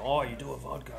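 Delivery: mock a Russian customer with a clumsy Russian accent; bad Russian accent. Mimicking Russian customers at the distillery in a bad Russian accent. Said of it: "that's a terrible accent".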